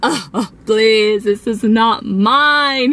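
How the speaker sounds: slow, gasping mock sobs; imitated sobbing. Crying teen begging to be believed, mock sobbing. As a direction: drag out the plea through pretend tears. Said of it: "crying and sobbing and like".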